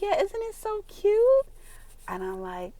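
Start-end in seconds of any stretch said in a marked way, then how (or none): 0.0-1.5 high, slow coo; gushing. Retold or imitated. Mom showing off a caught rabbit, high gushing coo. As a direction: coo it high, stretching the words.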